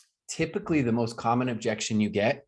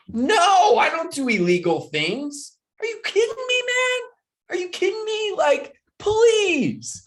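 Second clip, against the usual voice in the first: much higher, squeaky outraged voice; shocked friends; 5 dB louder, slower than usual. Retold or imitated. Coach imitates friends horrified by his joke, squeaky and outraged. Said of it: "They were like".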